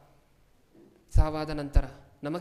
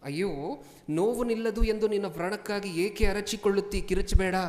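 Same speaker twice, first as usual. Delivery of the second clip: loud, raised-pitch Bible declamation; forceful scripture reading; 5 semitones higher, 8 dB louder than usual. Acted. Preacher reads God's rebuke to the congregation, loud and high.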